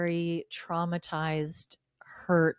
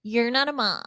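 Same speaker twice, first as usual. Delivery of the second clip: mocking husband voice; 2 semitones higher than usual.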